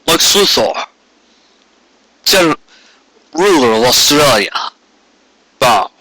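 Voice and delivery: lightly voiced Zod command; a hint of Zod ordering a bow; General Zod impression. Reader aside mimicking Zod's command, lightly voiced. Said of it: "I'm thinking General Zod in Superman two".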